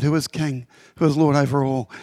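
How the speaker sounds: normal talking voice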